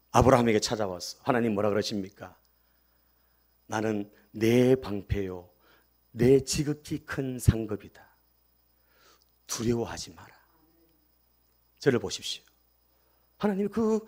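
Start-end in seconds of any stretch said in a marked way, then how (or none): 3.7-10.3 slow, hushed reassurance from God; God's comfort. Acted. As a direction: say it slowly and gently, like God calming a frightened man. Preacher voices God's comfort. (두려워하는 아브라함을 달래는 하나님의 느리고 조용한 목소리)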